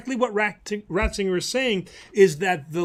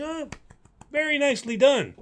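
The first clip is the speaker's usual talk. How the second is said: higher, lightly imitated admiring audience; mock approval; 8 semitones higher than usual. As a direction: play an onlooker politely praising an act. Acted villagers applauding the clown's act.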